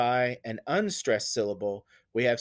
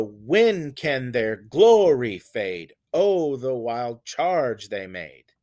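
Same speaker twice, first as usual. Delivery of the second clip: slow DUM-da-da, strong on "When", "glory", "Oh", "charge"; dactylic beat; 5 dB louder, slower than usual. Shown as a demonstration. Teacher demonstrating a dactyl, dactylic beat. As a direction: hit "When" and GLO in "glory", trail lightly after. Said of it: "a stressed syllable followed by two".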